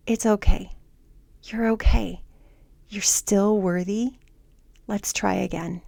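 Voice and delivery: calm yourself, hand on heart; soothing self-talk. Shown as a demonstration. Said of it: "and say".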